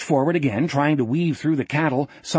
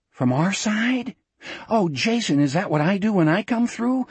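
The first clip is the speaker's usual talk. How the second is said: puzzled alarm; 6 semitones higher than usual. A narrator's reading. Alarmed, questioning inner thought.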